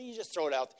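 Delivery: normal talking voice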